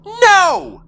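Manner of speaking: high piercing shout. Acted. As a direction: scream it out in protest. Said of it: "shouted Marie".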